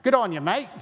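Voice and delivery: playful Australian impression; Australian accent. Shown as a demonstration. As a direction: imitate an Australian greeting a mate.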